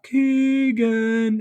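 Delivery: high, loud, drawn-out arena announcer call; announcer's yell. Retold or imitated. Announcer calls out the player's three, yelling long and high. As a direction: stretch it out like a hoarse-going hype announcer. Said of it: "the announcer yells out".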